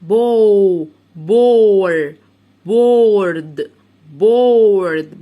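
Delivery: very slow, loud "بورد" with the vowel stretched; letter-by-letter blending. Shown as a demonstration. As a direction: build "بورد" loudly from بو to بور to the whole word. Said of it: "بمشي حرف اتنين تلاتة واخد الكلمة على بعضها".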